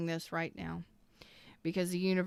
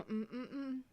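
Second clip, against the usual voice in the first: tight, high, muffled hums, fighting not to cry; swallowing a sob as tears come; choking back tears; 6 semitones higher, 10 dB quieter than usual. Real, not acted. Tarot reader moved by a reading, fighting tears. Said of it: "Oh, I'm gonna cry".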